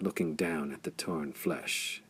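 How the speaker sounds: ordinary delivery, nothing marked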